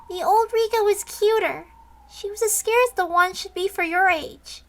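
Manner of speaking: a hint of a sly young girl. Same companion voice.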